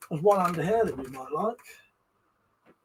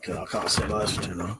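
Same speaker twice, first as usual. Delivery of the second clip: ordinary talk, nothing marked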